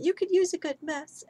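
high-pitched shout at normal volume; mock shout. A narrator's reading. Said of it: "she shouts at him".

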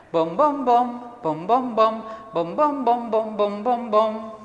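rhythmic sung plucks on "bom"; double bass imitation. Shown as a demonstration. Teacher shows pupils the bass part, imitating double bass. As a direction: bounce each note like a plucked bass string. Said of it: "el segundo instrumento va a ser el contrabajo".

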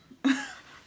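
high squealing laugh; delighted squeal laugh. Real, not acted. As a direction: let out a tiny squealing laugh. Delighted shriek at the singing.

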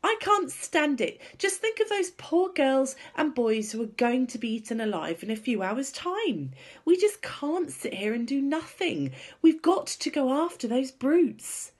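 high, loud, urgent plea; high-pitched, impassioned outburst; desperate outcry. A narrator's reading. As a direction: cry out that you can't bear it. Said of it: "she stood up and cried out".

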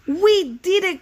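high, loud cheer of victory; triumphant cry. Acted. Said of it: "cried Toad".

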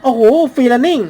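loud, high, thrilled shout; amazed cry at the running; excited shout. Real, not acted. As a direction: yell it out in awe. Thrilled by parkour chase.

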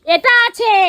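high, very loud outburst; exasperated shout. Acted. Showing the man what is inside, exasperated shout. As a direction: burst out at full volume.

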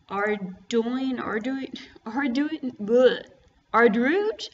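trying "Arduin" several ways, rising unsure; "Arduin" sounded out haltingly, ending in a question; unsure pronunciation. Shown as a demonstration. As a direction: fumble the word "Arduin", testing it. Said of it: "I don't even know how to say that".

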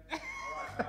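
a sudden high laugh; high burst of laughter. Real, not acted. Contestant laughs at his own joke, high laugh.

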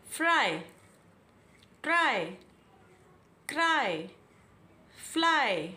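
फ्राई, ट्राई, क्राई, फ्लाई with a clear long आई at the end; long I demo. Shown as a demonstration. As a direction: stretch the final Y as आई. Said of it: "इसको हम आई बोल रहे हैं". (लास्ट वाई को लंबा आई बोलकर)

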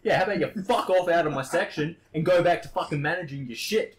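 high, loud aggressive telling-off; retold tirade. Ordering the manager out of his section, loud tirade. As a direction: tell him off at full volume.

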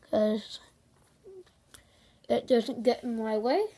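mouthguard muffling speech; talking around mouthpiece; with mouth guard in. Real, not acted.

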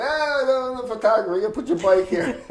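high, loud mock Greek villager; villager's welcome. Greek villager telling the returning photographer where to put his bike, high and loud. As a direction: play the cheerful old villager waving him in.

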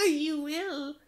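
loud, high witch cackle; witch laughing. A narrator's reading. Witch laughing at the fooled frog, loud cackling voice. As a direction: cackle shrilly, then squeal the words. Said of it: "laughing up her flappy sleeve".

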